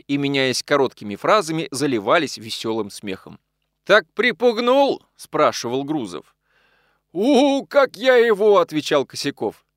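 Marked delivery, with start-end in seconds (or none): 3.8-5.0 high, loud, drawn-out question; crowing, stretched-out drunken query; tipsy gleeful question. A narrator's reading. Drunk pals laughing in merry exchange. 7.2-8.6 high, loud, drawn-out crow; slow, swaggering tipsy brag; drunken boasting. A narrator's reading. Drunk pal boasting amid merry laughter.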